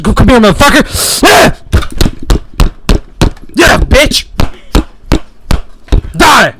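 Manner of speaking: high, loud attacking scream; spring the trap with a roar; ambush yell. Acted.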